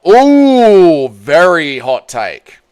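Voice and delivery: long, high, loud ooh, then drawn-out words; mock impressed. Streamer reacting to a viewer's hot take, mock impressed. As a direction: swoop down from a big teasing ooh.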